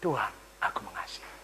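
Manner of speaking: soft, whispered confession; tearful whisper. Acted. Peter, moved to tears, answering the Lord in a whisper. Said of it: "membuat Petrus menangis".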